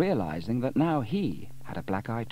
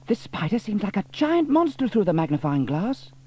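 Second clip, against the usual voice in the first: put-on high, louder girl's voice in wonder; young girl awed by the magnified spider; amazed girl; 11 semitones higher, 7 dB louder than usual. A narrator's reading. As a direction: marvel at the giant spider.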